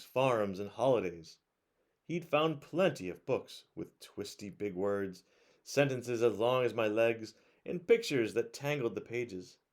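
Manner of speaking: put-on bright, lively read-aloud voice for kids; reading a picture book to children at bedtime; storybook narration.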